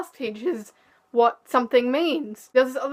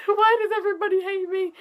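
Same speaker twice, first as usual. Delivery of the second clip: 1 mock crying; 8 semitones higher than usual. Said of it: "I didn't cry about it until I'd gone home".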